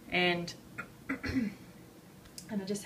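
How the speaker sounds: throat thick with phlegm; phlegmy throat. Real, not acted. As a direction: clear throat through phlegm. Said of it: "choking on phlegm".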